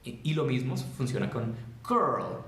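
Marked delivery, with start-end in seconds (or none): 1.9-2.4 put-on "girl" with hard G and drawn-out R-L; strong G. Shown as a demonstration. Teacher showing pronunciation, "girl" strong and drawn out. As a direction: hit the G of "girl", then hold R and L. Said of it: "la G es muy, muy fuerte".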